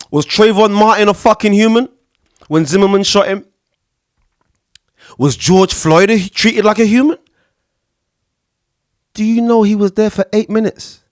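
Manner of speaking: heated outrage. Real, not acted. Podcaster angrily confronting listeners about police killings. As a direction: demand an answer, outraged. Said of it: "I ain't trying to start no shit".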